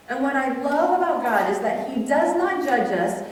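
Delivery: ordinary delivery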